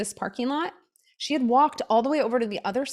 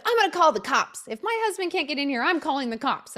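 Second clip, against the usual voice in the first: angry threat; 6 semitones higher than usual. Retold or imitated. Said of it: "yelling at me".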